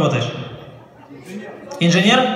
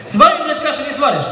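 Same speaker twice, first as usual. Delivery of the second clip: exasperated shout; 8 semitones higher than usual. Real, not acted. Comic exasperated at audience.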